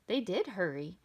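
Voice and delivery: usual speaking voice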